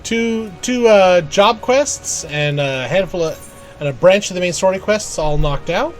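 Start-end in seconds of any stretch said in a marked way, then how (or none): none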